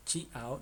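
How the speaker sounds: Chiao drawn out as chee-AH-o; wrong pronunciation. Shown as a demonstration. Teacher repeats the mistake for learners, mispronounced. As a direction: slowly sound the i in Chiao as a syllable. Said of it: "no es".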